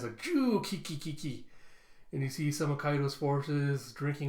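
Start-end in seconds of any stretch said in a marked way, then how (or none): 0.2-1.5 high "ju" into fading ki-ki-ki; put-on odd snickering laugh; cartoon cackle. A character's odd laugh imitated.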